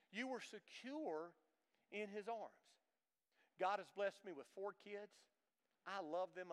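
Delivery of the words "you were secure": ordinary delivery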